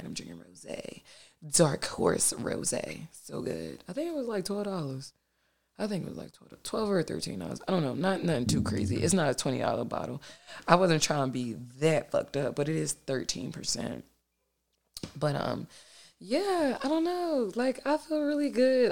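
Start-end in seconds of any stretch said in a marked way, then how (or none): 0.0-3.8 1 whispered aside. Real, not acted. Aside about her wine, whispered. 16.2-18.9 1 bright lift. Real, not acted. Upbeat about feeling good.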